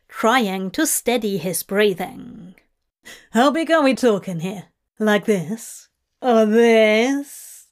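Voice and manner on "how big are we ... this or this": teasing excitement. Acted. Crowley teasing, still breathless.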